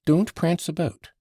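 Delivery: high, firm order; insistent. A narrator's reading. Grushenka ordering Mitya to settle, insistent. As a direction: tell him off insistently. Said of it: "she went on insistently".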